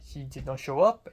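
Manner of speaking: imitated French accent with UP lifted high; French accent, rising ending. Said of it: "makes the last syllable high".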